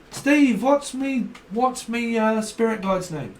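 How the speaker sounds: high-pitched, loud call then question; imitated questioner. Voices someone asking Steve.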